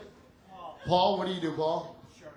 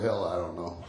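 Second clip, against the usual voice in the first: much lower, lazy mechanic; 12 semitones lower than usual. Retold or imitated. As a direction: shrug it off deep down like the mechanic. Mechanic voiced in story.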